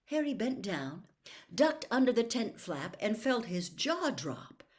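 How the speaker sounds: plain reading voice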